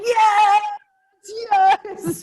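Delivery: high, loud delighted cheer; excited cheering. Retold or imitated. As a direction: cheer it out with joy. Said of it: "Sharon is going".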